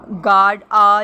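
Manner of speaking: "गार्ड" loud, then the bare long "आ"; loud, separated "गार्ड", "आ"; syllable sounded out. Shown as a demonstration. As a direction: punch out "गार्ड आ" to show the vowel. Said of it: "तो three syllables हैं".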